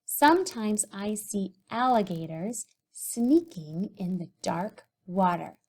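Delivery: words spoken slowly while writing; drawn-out dictation pace; reading aloud as she writes. Shown as a demonstration. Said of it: "I think I'm gonna write".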